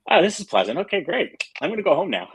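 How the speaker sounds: high-pitched sarcastic politeness, on the edge of laughing; mock cheerful. Patient replying to doctors' grim warning, mock cheerful.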